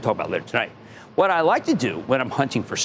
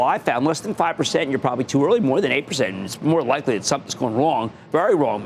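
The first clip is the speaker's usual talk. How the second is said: ordinary talk, nothing marked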